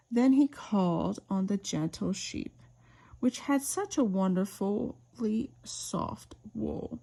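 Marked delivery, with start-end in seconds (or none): none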